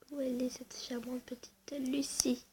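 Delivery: hushed, breathy, sneaking whisper; soft conspiratorial whisper. Shown as a demonstration. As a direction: whisper it like hunting for her. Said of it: "repeat whispered".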